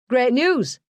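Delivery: bright, lightly voiced northern English cheer; a hint of northern English excitement; chipper northern accent. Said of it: "he said in his chipper northern accent".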